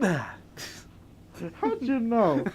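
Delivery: asked through laughter; laughing. Real, not acted. Friend caught out over cologne, laughing. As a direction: crack up while asking.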